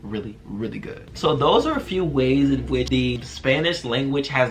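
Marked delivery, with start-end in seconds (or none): 0.0-1.1 softer and softer until whispered; playful fade-out to a breathy whisper; fading to a whisper. Real, not acted. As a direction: let the repeated words die away to a whisper. Trailing repeated really.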